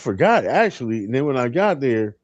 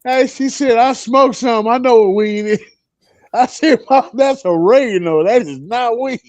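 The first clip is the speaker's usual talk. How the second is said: laughing; 7 semitones higher than usual. Real, not acted. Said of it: "I couldn't stop laughing after that".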